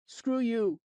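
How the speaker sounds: put-on high, loud bratty kid; rude kid. A narrator's reading. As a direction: sneer it like a mouthy punk. Rude kid taunting narrator.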